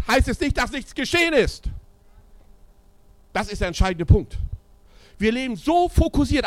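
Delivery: high and loud, driving preaching; full-force preaching. Acted. Preacher challenging the church's focus on numbers, full-force preaching. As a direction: preach it hard and keep the pressure on.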